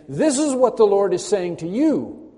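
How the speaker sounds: loud, high-pitched preaching; emphatic proclamation. Acted. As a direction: proclaim it forcefully straight to the congregation. Preacher proclaims the message to hearers.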